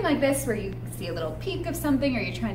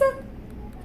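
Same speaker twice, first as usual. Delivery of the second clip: sharp high breathy gasp; 12 semitones higher, 7 dB quieter than usual. Pretend. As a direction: gasp in surprise for the kids. Storytime suspense before reveal.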